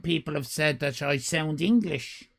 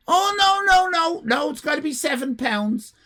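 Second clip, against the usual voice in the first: much higher, loud fussy refusal; prim clerk; 11 semitones higher, 4 dB louder than usual. Retold or imitated. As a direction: play the rigid ticket clerk. Clerk refusing six fifty.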